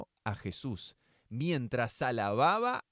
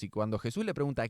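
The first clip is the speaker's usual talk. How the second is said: ordinary talk, nothing marked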